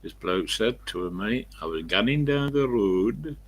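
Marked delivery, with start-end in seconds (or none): 1.6-3.3 sing-song Geordie with raised pitch; Geordie accent. Retold or imitated. Bloke telling his mate about his walk, Geordie accent. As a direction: imitate a Geordie, stretching and lilting the end. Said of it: "you got the Geordies".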